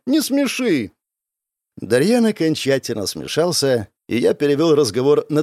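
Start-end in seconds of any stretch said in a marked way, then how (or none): 0.1-0.9 high-pitched amused dismissal; scoffing. A narrator's reading. Girl laughing off her jealous friend, scoffing. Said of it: "фыркнула Беляна".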